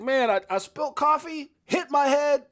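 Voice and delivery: whining about the spill and bump; retold self. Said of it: "I was like".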